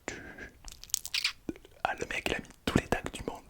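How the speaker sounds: ordinary talk, nothing marked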